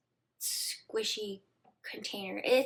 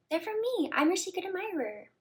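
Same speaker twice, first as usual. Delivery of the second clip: much higher mock man's voice; imitating date; 9 semitones higher than usual. Narrator mimicking her date confessing, much higher. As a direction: mimic him smugly.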